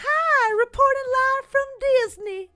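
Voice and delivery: chirpy reporter voice. Retold or imitated. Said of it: "she's like".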